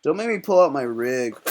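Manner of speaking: usual speaking voice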